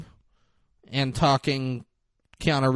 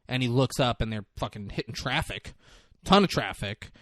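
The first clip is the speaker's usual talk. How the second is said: usual speaking voice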